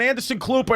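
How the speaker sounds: "Klooper" with an L slipped into Cooper; mispronounced name. Retold or imitated. Quoting the funeral director's wrong name, "Klooper" with an added L. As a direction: say Anderson Klooper as a confident misnaming. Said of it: "he called him Anderson Klooper".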